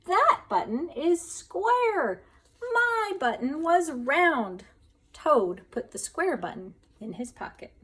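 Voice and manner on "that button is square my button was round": high, loud, slow wail. A narrator's reading. Toad wails at the raccoon, slow high wail. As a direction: stretch it into a wail.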